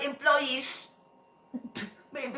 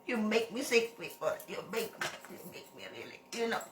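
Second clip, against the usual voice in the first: much softer and lower, fast and fed up; disgusted murmur; 4 semitones lower, 13 dB quieter than usual. Real, not acted. Boss muttering her disgust at the viewers, softly. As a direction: mutter it in disgust, half under breath.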